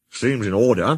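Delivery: plain reading voice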